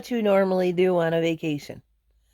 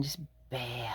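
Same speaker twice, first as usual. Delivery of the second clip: whisper; 8 semitones lower, 17 dB quieter than usual. Real, not acted. Migraine hitting.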